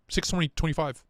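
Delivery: ordinary talk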